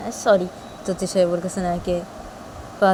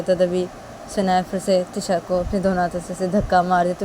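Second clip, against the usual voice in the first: usual speaking voice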